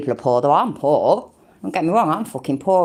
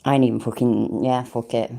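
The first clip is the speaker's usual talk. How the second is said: usual speaking voice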